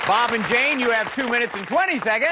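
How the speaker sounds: high, rousing game show call; excited announcing. Real, not acted. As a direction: fire up the teams as you announce. Host announces times to cheering teams.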